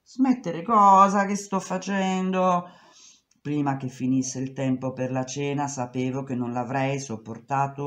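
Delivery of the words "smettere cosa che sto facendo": mocking boss. Boss mocks her protest.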